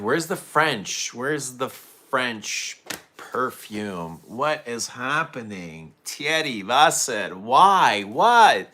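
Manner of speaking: mock outrage at a perfume that let him down; retold furious disbelief. Perfume fan reliving his disappointment, hammed-up outraged disbelief. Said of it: "I was so angry".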